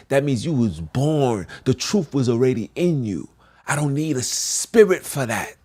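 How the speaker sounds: ordinary talk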